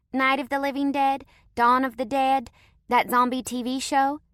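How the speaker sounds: put-on high little-girl voice with a Southern twang; a kid drawling in a Southern accent; child with a country twang. A narrator's reading. Southern girl telling strangers her zombie credentials, with a Southern twang.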